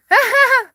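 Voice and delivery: high, loud burst of laughter. Real, not acted. Amused that chat thinks she attends church, loud high laugh.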